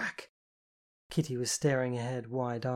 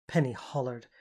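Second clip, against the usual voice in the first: ordinary delivery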